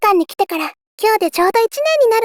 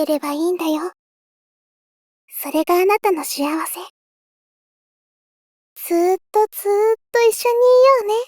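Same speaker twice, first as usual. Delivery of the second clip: yandere. Acted. Possessive obsessive love speech.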